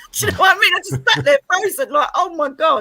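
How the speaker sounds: high, loud burst of amazement; amazed, high-pitched retelling; excited disbelief. Retold or imitated. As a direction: blurt it out high and loud, just after a laugh. Amazed retelling of seeing the apparition.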